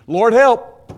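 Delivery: high, loud shout; loud cry for help. Acted. Preacher casting cares on God, loud cry. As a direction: call out urgently to heaven.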